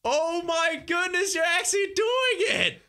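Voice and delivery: high, drawn-out amazed cheer; excited cheering. Real, not acted. Coach cheers on girl landing the hard jump, excited cheering. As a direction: whoop with astonishment, stretching the words.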